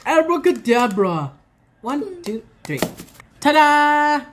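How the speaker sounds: playful magic-trick announcer, big finish on ta-da; kids party magician. Pretend. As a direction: count it down like a stage magician. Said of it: "Watch this".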